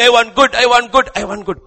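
loud, high pleading in mock tears; imitated crying plea. Preacher acting Esau crying for good, loud mock plea. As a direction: beg repeatedly, hammed-up. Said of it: "He's crying".

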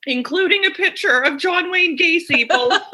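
high, laughing through the words; giggling at the creepy photo; real laughter breaking into the words. Real, not acted.

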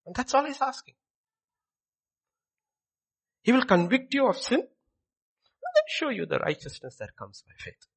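loud, high, driving sermon voice; preaching at full force. Acted. Preacher pleading with the congregation, loud and high. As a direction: preach it out, rising and pressing.

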